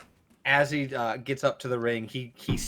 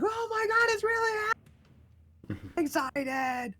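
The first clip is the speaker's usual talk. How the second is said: very high squealed fan voice; excited fans. Acted. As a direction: imitate a crowd losing it. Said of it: "people are like".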